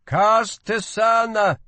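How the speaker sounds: high, slow, loud hawking; urging market cry. A narrator's reading. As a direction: urge passersby to buy. Merchant calls out.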